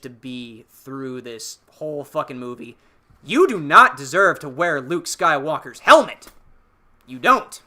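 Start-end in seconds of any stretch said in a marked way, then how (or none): 3.2-7.5 loud, emphatic telling-off, half in jest; mock scolding. Podcaster scolding Rey over Luke's helmet, mock indignant. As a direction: lay down the law, punching each word.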